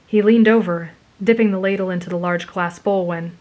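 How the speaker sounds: ordinary delivery, nothing marked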